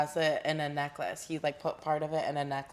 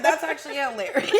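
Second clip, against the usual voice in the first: laughing and louder; 8 semitones higher, 8 dB louder, faster than usual. Retold or imitated. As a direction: giggle through it loudly. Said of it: "I was like".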